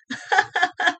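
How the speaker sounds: forced high hollow laugh; a put-on polite laugh; fake laugh. Shown as a demonstration. Shows the fake laugh students give professors, forced laugh. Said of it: "this be my fake laugh".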